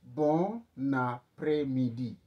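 Bon après-midi drawn out slowly, bon a-prè-mi-di; teacher slows down the phrase to repeat; slow model. Shown as a demonstration. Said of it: "repeat that".

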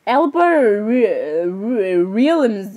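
slow, drawn-out sounding out; hesitant reading. Real, not acted. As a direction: stumble slowly through an unfamiliar name. Stumbles reading an unknown name.

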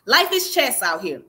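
usual speaking voice

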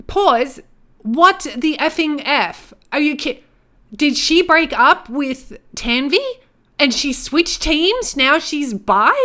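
loud, high, drawn-out disbelief; incredulous. Real, not acted. Gamer learning she switched teams, incredulous. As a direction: demand answers, stressing each phrase slowly. Reacts to surprise breakup.

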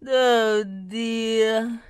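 long, loud, weary sigh of worry; drawn-out dismay. A narrator's reading. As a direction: groan it out slowly. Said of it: "he sighed".

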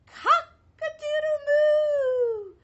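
very high, loud, slow sung crow; a cow belting it out; stretched-out mooing crow; cow's crow. Acted. Cow crows.

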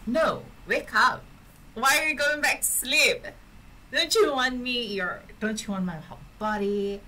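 hammed-up pleading to a sleepy partner; mock pleading. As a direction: playfully beg him to stay awake.

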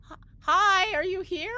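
high, tentative call into the dark. Acted. Player's character calling to the creature, high hopeful call. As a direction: call out high, stumbling on the first word. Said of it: "I'm gonna call out".

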